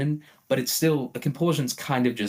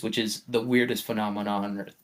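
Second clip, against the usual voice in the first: usual speaking voice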